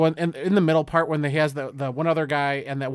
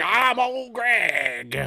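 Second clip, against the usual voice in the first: slow, drawn-out, higher creepy voice; Old Greg; 6 semitones higher, much slower than usual. Retold or imitated. Recapping the movie to a cohost, very slow creepy Old Greg voice. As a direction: stretch each word like a lurking weirdo. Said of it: "old Greg pops out of it".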